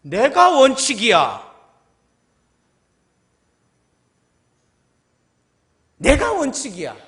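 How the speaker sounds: slow, loud, weight on 내가; God's declaration. Acted. God answering, slow and loud on 내가. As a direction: declare it with authority. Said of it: "하나님 뭐라고 말씀하시게요".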